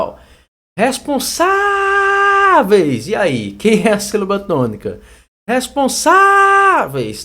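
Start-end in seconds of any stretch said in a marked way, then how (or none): 0.8-3.1 high, drawn-out call on the SA of "Responsáveis"; calling-out shout. Shown as a demonstration. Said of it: "chamando a palavra pelo portão". 5.5-7.2 loud, high "Responsáveis" stretched on SA; calling-out shout. Shown as a demonstration. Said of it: "Tá vendo que é o sa?".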